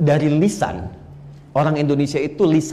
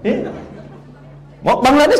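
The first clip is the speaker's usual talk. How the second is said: usual speaking voice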